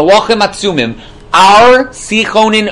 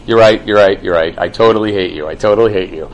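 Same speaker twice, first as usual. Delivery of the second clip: low, sarcastic agreement; mock agreeing, dripping sarcasm; sarcastic God; 10 semitones lower than usual. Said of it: "that's sarcasm".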